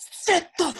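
drawn-out shout, each word hammered; hammed-up film outburst, slow and loud; mock shouted order. Podcaster quotes a film's boardroom outburst, mock shout.

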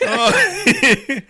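a burst of high laughter; real laugh. Real, not acted. Host laughing with joy at the guests' idea.

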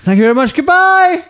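high, rushed-out farewell squeal; comic sign-off. Pretend. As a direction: bail out with a silly, very high goodbye. Abrupt comic sign-off after losing it.

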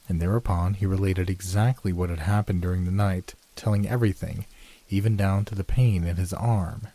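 ordinary delivery, nothing marked

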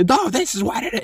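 high, strained mock yell; retold yelling. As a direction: act out a man yelling at a stadium crowd. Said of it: "and starts yell".

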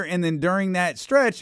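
usual speaking voice

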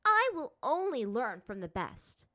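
loud, high, slow insistence; determined. A narrator's reading. Azula refusing any other teacher, determined. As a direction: declare it with stubborn force. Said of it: "set her jaw with determination".

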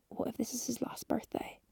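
hushed unvoiced whisper of a dark thought. Voicing her intrusive thought in a whisper. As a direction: whisper it like a guilty secret.

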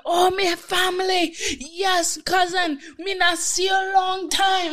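high, shouted Bajan welcome; drunk Caribbean greeting. Retold or imitated. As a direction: shout a drunk family greeting in island accent. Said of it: "he's like".

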